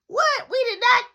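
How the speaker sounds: loud, high-pitched denial; outraged yelped denial; indignant disbelief. Retold or imitated. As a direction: protest in shock at a false accusation.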